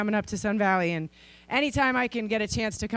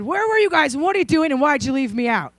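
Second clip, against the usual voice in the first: louder, higher demanding questions; indignant kid; 4 semitones higher, 5 dB louder than usual. Retold or imitated. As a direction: fire off the complaints. Kid confronts dad and brother.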